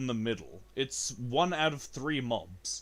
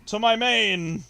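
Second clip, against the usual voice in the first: urgent call; 8 semitones higher, 9 dB louder than usual. Real, not acted. Switches character in danger.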